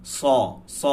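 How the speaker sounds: স as a full rounded "saw"; heavy saad. Shown as a demonstration. Teacher drilling the letter, heavy স. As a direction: thicken the s of স, round the vowel. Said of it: "পুরো পার্থক্য আছে".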